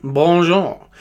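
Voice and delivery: high-pitched mock French accent; cartoon Frenchman, pitched well up. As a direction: put on a hammy French accent. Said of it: "he's very stereotypical French anyway".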